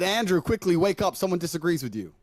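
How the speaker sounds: a lightly played alarmed friend rousing him; Aiden's urgent message. Friend urging Andrew to wake up, mock urgent. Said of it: "Aiden messaged me and said".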